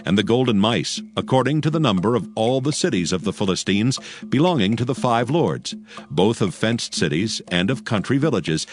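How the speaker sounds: usual speaking voice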